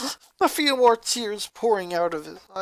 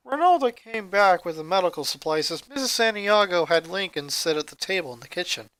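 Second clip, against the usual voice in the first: plain reading voice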